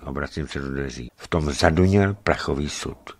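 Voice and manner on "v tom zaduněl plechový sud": ordinary delivery, nothing marked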